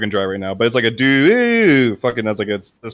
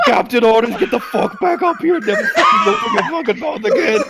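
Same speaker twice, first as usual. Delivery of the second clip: laughing angry order. Acted. Captain ordering Grog back up, laughing shout. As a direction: bark orders while cracking up.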